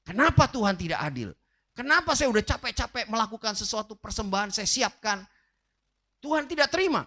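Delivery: acting out Cain's indignant complaint; angry at God. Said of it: "Dia marah dengan Tuhan".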